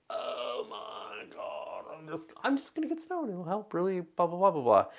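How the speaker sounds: high, frazzled, fed-up voice; frazzled exasperated outburst. Retold or imitated. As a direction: blurt it out like a stressed parent. Stressed parent's craving thought.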